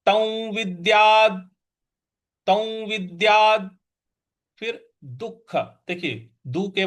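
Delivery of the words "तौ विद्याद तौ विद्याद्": slow, clear "तौ विद्याद" with the visarga as "तौ"; careful recitation demo. Shown as a demonstration. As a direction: say "तौ विद्याद" slowly, ending on a clipped द्. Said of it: "इसका उच्चारण बन जाता है".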